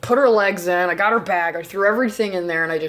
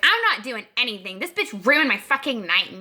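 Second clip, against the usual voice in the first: whiny mimicry of a complaining friend; bratty mock complaint; mocking angry refusal; 2 semitones higher than usual. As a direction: imitate her griping in a put-on voice.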